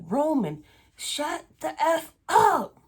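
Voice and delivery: high, loud mock yell at the dog; exasperated high shout at a noisy dog. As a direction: snap at the barking dog to shut up. Yelling at barking dog.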